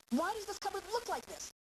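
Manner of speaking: high-pitched parental accusation; accusing. Acted. Parent caught out at home over a cupboard, accusing. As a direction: demand an explanation, voice jumping high. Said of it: "When you make your accusations".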